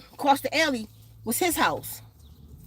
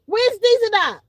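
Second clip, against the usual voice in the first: loud call across the room; calls out loudly to someone nearby; 7 semitones higher, 5 dB louder than usual. Real, not acted. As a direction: shout to someone off mic. Calling out to others.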